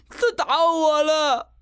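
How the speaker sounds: put-on very high, drawn-out child's complaint; wailing boy tattling. A narrator's reading. Boy points at his attacker for mother, wailing tattling. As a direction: whine it slowly up high, accusing. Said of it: "金锁指着罗贾成".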